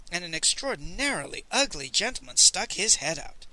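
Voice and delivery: usual speaking voice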